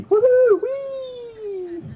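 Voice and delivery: high whoop into a long, held whee; gleeful whoop. Acted. Hiker going down the easy slope, gleeful. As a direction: squeal like riding a slide. Acts sliding down the hill.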